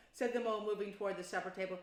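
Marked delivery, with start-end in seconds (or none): none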